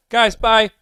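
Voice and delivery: high, loud mock sign-off; bright fake exit to the chat; playful goodbye. Shown as a demonstration. Streamer pretending to leave the stream, high loud mock goodbye. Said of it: "watch this".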